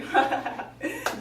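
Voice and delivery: short acted giggle; giggling. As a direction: giggle at the fooled people. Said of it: "They were, like, giggling".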